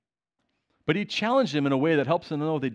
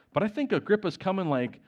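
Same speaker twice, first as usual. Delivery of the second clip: normal talking voice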